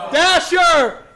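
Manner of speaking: loud call across the room. Real, not acted. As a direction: shout his name to call him over. Calling a player to the mic.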